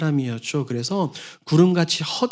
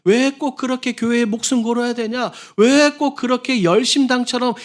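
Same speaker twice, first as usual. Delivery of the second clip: scornful nagging; 7 semitones higher than usual. Retold or imitated. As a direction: scoff at someone too eager at church. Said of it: "이런 소리들 들으신 경험들".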